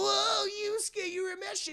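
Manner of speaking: exaggerated panic at seeing a ghost; mock freaked-out. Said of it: "they're freaked out".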